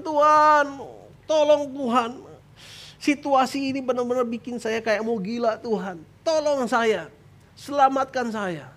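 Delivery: high, drawn-out cries to God; desperate acted plea. Believer near madness begs God, high desperate plea. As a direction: beg for rescue at wit's end.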